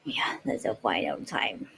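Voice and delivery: hushed whisper to herself; soft unvoiced muttering aside. Real, not acted. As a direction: drop to a near-silent whisper.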